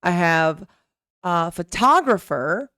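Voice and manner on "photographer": PHOTOGRAPHER with a strong second syllable, pho-TA-grapher; stress on TA. Shown as a demonstration. Teacher shows word stress, hitting TA in "photographer". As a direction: lean on the "to" of "photographer". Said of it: "Ta is going to be the stress word".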